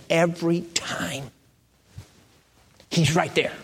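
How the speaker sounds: slow whisper on EVERY TIME; hushed emphasis. Real, not acted. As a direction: whisper "every time" slowly, letting each word sink in.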